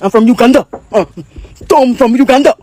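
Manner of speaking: loud, hammed-up fake African accent; mock African accent. Mocks the idea that Americans should sound African, fake African accent. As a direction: caricature an African accent with punchy nonsense syllables. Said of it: "with some type of accent".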